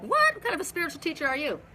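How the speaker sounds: high, quick imitation of a shocked listener; mock incredulity. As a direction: shoot up in pitch on a mock-shocked "what". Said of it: "You're like".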